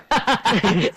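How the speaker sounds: short laugh. Acted. Laugh after apology.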